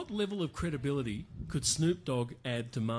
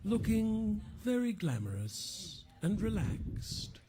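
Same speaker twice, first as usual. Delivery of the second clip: slow, hushed nature documentary narrator; soft wildlife-film narration; David Attenborough; 3 semitones higher, 5 dB quieter, slower than usual. Pretend. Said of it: "I'm gonna do the David Attenborough".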